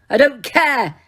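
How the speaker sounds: yell. A narrator's reading. Harry yelling at the portraits, loud. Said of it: "Harry yelled at them".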